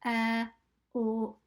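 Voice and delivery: Felt drawn out slowly, sound by sound; stretched out slowly. Shown as a demonstration. Teacher sounds out Felt slowly for young writers. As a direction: stretch Felt out long so each sound is heard. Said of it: "if I say it slow".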